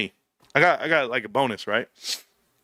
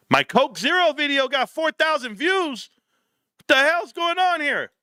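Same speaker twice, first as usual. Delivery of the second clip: much higher, astonished about views; surprised; 13 semitones higher than usual. Real, not acted. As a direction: sound amazed at the number, pitched way up. Surprised at view count.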